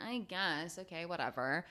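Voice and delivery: soft, deflated shrug of a reply; unenthused heroes shrugging off the mission; mumbled, bored reluctance. Retold or imitated. Heroes reluctantly agree to save planet.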